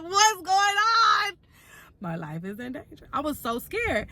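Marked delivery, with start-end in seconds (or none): 0.0-1.3 startled shriek. Retold or imitated. I about jump.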